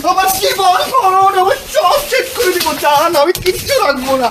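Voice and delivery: high, tearful wail; desperate wailing. Real, not acted. Spurned lover begging the partner to stay, high desperate wailing. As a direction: cry out the plea, voice pitched high.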